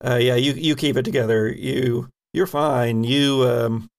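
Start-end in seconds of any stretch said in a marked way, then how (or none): none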